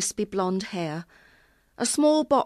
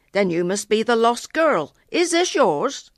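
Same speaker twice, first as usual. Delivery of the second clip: put-on stationman's strange rural accent, louder, lifting high on the question; louder, higher questioning in a country accent; 5 semitones higher, 6 dB louder than usual. A narrator's reading. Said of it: "he asked in a strange accent".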